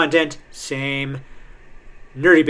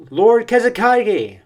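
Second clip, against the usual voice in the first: high, loud, drawn-out call; 9 semitones higher, 5 dB louder than usual. Acted. Young ninja calling to their lord, raised call. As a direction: cry out to a leader, slowly and high.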